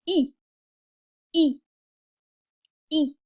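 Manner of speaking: "E" with lips pulled tight as in a smile; tight French I. Shown as a demonstration. Teacher modelling the French I, lips tight. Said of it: "your lips should be very tight".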